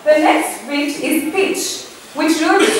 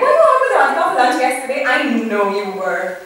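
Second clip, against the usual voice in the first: fast, smiling, teasing question; smirking tease; faster than usual. Retold or imitated. Teasing a colleague about lunch: fast, smirking. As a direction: rush it out with a knowing grin. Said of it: "I'm smirking, okay, I'm smiling".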